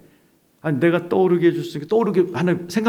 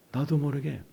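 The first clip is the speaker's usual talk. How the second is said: ordinary talk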